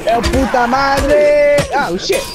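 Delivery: panicked yell. Real, not acted. About to die.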